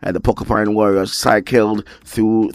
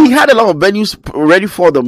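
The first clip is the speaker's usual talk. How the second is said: exasperated; 10 semitones higher, 5 dB louder than usual. Real, not acted. Frustrated about wasted planning.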